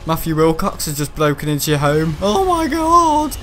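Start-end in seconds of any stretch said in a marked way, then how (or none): 2.2-3.4 high, drawn-out mock shock; slow, high-pitched pretend panic; mock horror. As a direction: stretch it out in hammed-up alarm. Mock alarm at a break-in joke.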